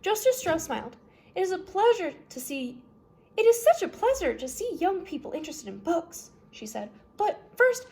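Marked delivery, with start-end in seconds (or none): 1.4-6.3 higher, delighted kindly voice; a light voicing of a pleased older lady; Justice Strauss voice. Judge delighted by the children's reading. 7.2-7.9 high, loud bright turn; Justice Strauss voice. Acted. Judge steers the children to the recipe.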